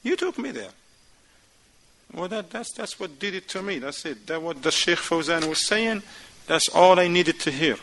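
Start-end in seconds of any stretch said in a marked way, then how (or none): 0.0-4.3 soft, restrained voice of a patient wife; lightly acted, understated wifely reminder; wife calmly explaining. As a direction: reason with him quietly. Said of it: "She will tell him".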